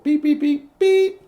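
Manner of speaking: high, loud imitated beeps; keypad beeps. Woman typing in the alarm code, high beeps. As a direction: mimic an alarm keypad, last beep longest. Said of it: "She typed in the code".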